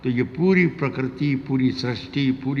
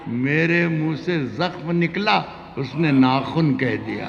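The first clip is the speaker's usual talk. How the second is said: romantic shayari; 3 semitones higher, 4 dB louder, slower than usual. Acted. Poet recites ghazal.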